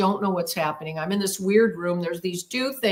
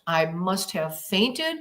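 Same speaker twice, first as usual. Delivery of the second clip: usual speaking voice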